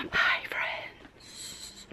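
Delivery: soft whispered goodbye. Real, not acted. Signing off softly.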